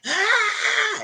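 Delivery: short high-pitched mock scream; high "ah" scream, played for effect; imitated scream. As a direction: let out a quick imitation shriek. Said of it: "so Drew just screamed".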